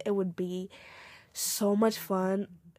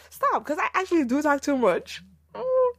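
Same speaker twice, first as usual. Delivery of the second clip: louder, higher self-teasing cry; playful outburst; 6 semitones higher, 5 dB louder than usual. Real, not acted. Scolding herself for talking too much, playful loud outburst. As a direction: laugh at her own rambling.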